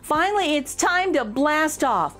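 plain reading voice

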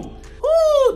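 drawn-out, high-pitched "woo"; high whoop. Real, not acted. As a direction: let out a high whoop at the juicy thought. Reacting to imagined steamy scene.

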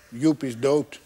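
subdued, understated grief; sad. A narrator's reading. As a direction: say it slightly low, mourning. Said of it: "zei hij verdrietig".

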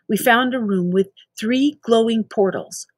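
ordinary delivery, nothing marked

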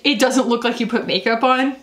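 usual speaking voice

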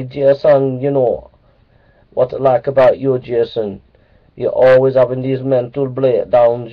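a fond Jamaican uncle teasing his nephew; Jamaican accent. Pretend. Uncle Joe teasing Jason about YouTube breakdowns, in a Jamaican accent. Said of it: "Just doing my Jamaican accent".

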